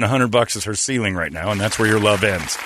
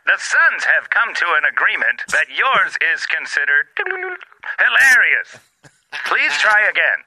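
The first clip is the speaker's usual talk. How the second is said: higher automated hotline voice; phone menu voice; 8 semitones higher than usual. Acted. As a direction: read it like a recorded menu.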